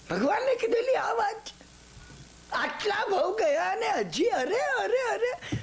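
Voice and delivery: high, fervent lament on arey; rising exclamation. Real, not acted. Preacher to listeners, high fervent exclamation. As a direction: cry out in dismay to the congregation.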